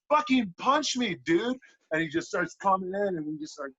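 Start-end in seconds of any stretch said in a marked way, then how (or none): 0.1-1.6 high, slow, eager push; urging on. Retold or imitated. Bleeding wrestler urging his partner to keep hitting, high eager push. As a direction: egg him on to keep swinging. Urging opponent mid-match.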